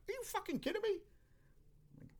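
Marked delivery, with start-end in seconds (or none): 0.1-1.0 very high-pitched mock disbelief; incredulous outburst. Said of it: "I was like".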